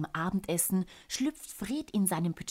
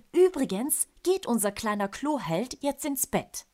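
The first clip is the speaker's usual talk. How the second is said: mock stern; 6 semitones higher, 5 dB louder than usual. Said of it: "mahnt Tante Ricky mit strenger Miene".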